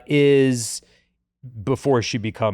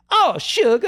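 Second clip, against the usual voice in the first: high, hammed-up Southern sweetness; Southern belle accent; 12 semitones higher than usual. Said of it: "modeled on, like".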